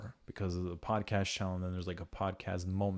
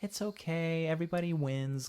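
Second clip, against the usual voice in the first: coddling parent; 9 semitones higher than usual. Acted. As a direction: mimic a too-lenient parent consoling a kid. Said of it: "You can't just be like".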